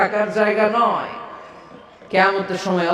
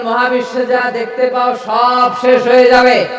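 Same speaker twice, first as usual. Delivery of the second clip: higher, louder proclamation; solemn proclamation; 6 semitones higher, 5 dB louder than usual. A narrator's reading. Preacher voicing God's proclamation that all will end, loud. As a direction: declare it with full weight.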